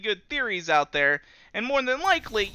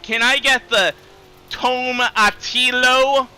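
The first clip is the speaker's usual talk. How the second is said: higher and louder, tomatillo stretched out slowly; mock Karen voice; 6 semitones higher, 7 dB louder, slower than usual. Imitating a lady demanding sauce from the waiter, slow and loud. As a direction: drag out tomatillo like she's talking to a child. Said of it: "talking really slowly".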